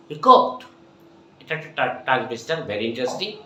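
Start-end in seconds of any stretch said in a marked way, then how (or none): 0.1-0.6 hiccuped jumps high and loud; punched hiccuped. Shown as a demonstration. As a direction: hit hiccuped hard and high. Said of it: "A happy hippo".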